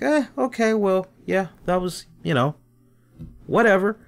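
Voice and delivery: high, dismissive mock shrug; shrugging meh. A fan shrugging off the surprise appearance, dismissive. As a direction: brush it off with a bored "eh". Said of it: "you're like".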